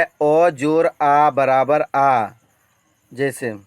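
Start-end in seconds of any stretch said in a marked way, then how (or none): 0.0-2.3 slow dictation. Shown as a demonstration. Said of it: "teacher recites formula".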